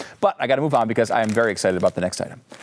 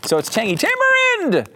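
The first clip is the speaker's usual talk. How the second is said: excited. Real, not acted. Reading the exclamation point.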